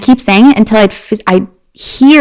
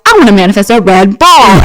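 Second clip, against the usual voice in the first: lightly voiced kid making a wish; mock childish earnestness; eager twelve-year-old; 3 dB louder than usual. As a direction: imitate your determined younger self. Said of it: "I'm, like, twelve being like".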